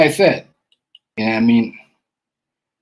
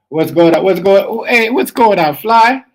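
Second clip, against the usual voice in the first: loud, raised-pitch hail; calling out greeting; 6 semitones higher, 7 dB louder, slower than usual. Real, not acted. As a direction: call out to get his attention. Calling out to Fly joining.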